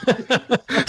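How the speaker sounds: high-pitched squeal breaking into laughter; laughing mock disbelief. Podcaster retells paramedics' reaction, laughing mock shock. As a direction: imitate baffled rescuers while cracking up.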